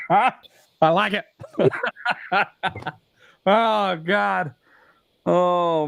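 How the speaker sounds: high, breathless laughter; can't stop laughing. Real, not acted. Still laughing at joke.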